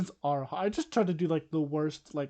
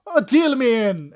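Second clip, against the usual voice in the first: higher and much louder, gleeful; eager shout; 7 semitones higher, 11 dB louder than usual. Acted. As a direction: shout it out, thrilled to join the game. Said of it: "And she goes".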